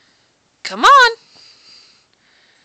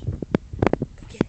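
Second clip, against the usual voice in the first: whisper; 6 dB quieter, slower than usual. Real, not acted. Quiet game with the dog.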